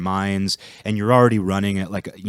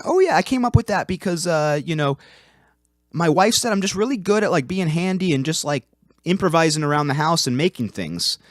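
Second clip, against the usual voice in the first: pitched-up, hammed-up handyman voice; mock folksy coworker proudly explaining his email; exaggerated folksy voice; 8 semitones higher than usual. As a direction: play him as a cheery, corny guy explaining his email name. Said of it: "he didn't really talk like that".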